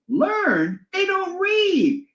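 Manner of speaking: high, slow mocking scoff. High, slow scoff at Orwell's question about learning.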